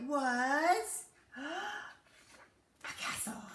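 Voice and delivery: slow, unvoiced gasping reveal; awed whisper. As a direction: gasp and whisper in wonder. Gasp then whisper.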